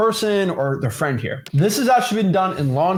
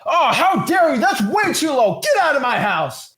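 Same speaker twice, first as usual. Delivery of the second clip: hammed-up outrage at a lowball; mock angry seller; 11 semitones higher than usual. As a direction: play the offended seller yelling. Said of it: "maybe the seller gets mad".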